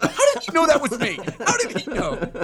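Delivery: high, laughing outburst of surprise; mock disbelief. Caught out on a prank call, high-pitched mock disbelief. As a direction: squeal it in comic exasperation.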